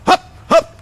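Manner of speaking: high, barked marching chant; mock goose-step cadence shouted at departing officers; mock goose-step call. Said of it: "do the goose step while you go".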